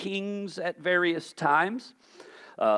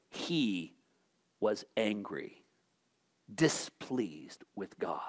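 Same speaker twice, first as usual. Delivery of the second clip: whispered, slow, much softer and lower; hushed whisper; 8 semitones lower, 10 dB quieter, slower than usual. Real, not acted. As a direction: lean in and breathe the question. Sermon question drops to hush.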